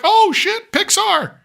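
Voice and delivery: high-pitched excited outburst. Retold or imitated. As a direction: squeal with delight at the Pixar logo. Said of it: "I got excited".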